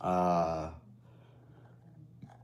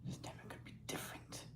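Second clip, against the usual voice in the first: fast, breathy whisper; 22 dB quieter, much faster than usual. Acted. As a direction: whisper it quickly to yourself. Sudden whispered line.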